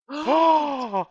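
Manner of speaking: high gasp and "oh"; excited gasp. Real, not acted. Player hearing about pancakes, excited gasp.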